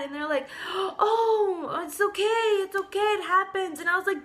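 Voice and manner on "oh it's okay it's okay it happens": high-pitched, gasping fake concern; mock reassurance. Nail technicians playing down a bleeding finger, mock reassuring. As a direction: coo it high, imitating salon staff smoothing things over. Technicians soothing her over injury.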